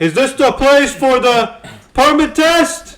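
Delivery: loud, high, drawn-out mock bellow; hammed-up old veteran yelling; imitated yelling. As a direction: bellow it slowly like the man you're imitating. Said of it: "He's just, like, yelling".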